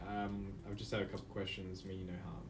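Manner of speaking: put-on soft, low coaxing to a scared child; gentle reassurance. Player coaxes a frightened child, soft and gentle. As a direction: ease toward him softly.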